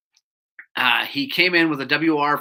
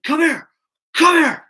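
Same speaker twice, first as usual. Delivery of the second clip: high-pitched, insistent shouted order; mock screaming; 11 semitones higher, 4 dB louder than usual. Angry customer ordering the mechanic outside, mock screaming. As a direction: imitate an angry man yelling a summons. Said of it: "so he's screaming at me".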